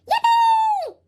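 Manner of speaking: very high loud squeal of joy; yelled cheer. A narrator's reading. Kids cheering as the snowballs fly, loud squeal. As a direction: cheer with all your might. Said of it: "everybody yelled".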